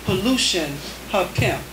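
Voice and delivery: spare, paused haiku reading; deliberate poetry reading; haiku recitation. Acted. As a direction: let each haiku land.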